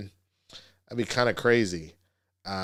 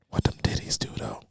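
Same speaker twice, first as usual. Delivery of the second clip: whispered mock confession; mock creepy whisper. Mocking a creepy guy whispering his apology down the phone. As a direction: hush it like a creepy secret. Mock late-night voice message.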